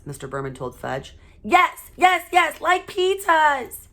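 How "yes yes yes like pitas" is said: put-on high, loud, slow insistent kid demand; hollering toddler. A narrator's reading. Toddler demanding loafers like his brother's, hollering high. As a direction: drag out the demand like a stubborn toddler. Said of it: "Fudge hollered".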